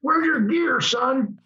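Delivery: put-on Southern officer scolding a young man; southern accent. Commander rebuking the pilot, southern accent. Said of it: "he had this southern accent".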